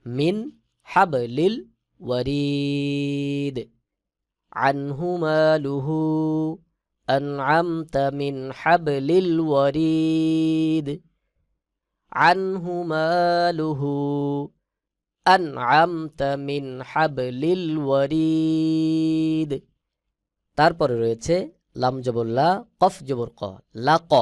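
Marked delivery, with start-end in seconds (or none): 0.1-3.6 ওয়ারিদ ends stopped, the long vowel stretched slowly; correct pronunciation. Shown as a demonstration. Teacher shows the correct recitation of ওয়ারিদ. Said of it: "সহি উচ্চারণ হবে". 4.7-19.5 slow melodic tilawat, long held notes; Quran recitation. Shown as a demonstration. Teacher recites the verse melodically for students. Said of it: "সহি উচ্চারণ হবে".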